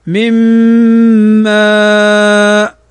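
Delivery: "من ماء" with the noon merged into the meem, held long with ghunna; slow Quranic recitation of "من ماء"; tajweed recitation. Shown as a demonstration. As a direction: stretch "ماء" slowly, nasalized. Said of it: "تنطق هكذا".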